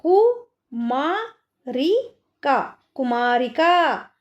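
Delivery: कु, मा, री, का को अलग अलग धीरे बोलकर फिर कुमारिका जोड़ें; कु मा री का, हर अक्षर अलग, फिर पूरा शब्द; syllable by syllable. Shown as a demonstration. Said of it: "तीन मात्राओं का यूज़ हुआ है". (बच्चों को शब्द तोड़कर समझाती टीचर, धीरे धीरे)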